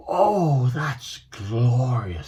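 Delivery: slow, relieved and savoured; stretching sigh. Acted. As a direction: stretch out the words with pleasure. Ember stretching after release.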